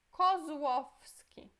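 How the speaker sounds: slow Kozłowski with ł as u; Polish ł. Shown as a demonstration. Teacher demonstrating ł sounding like U, slow. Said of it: "Esse é U".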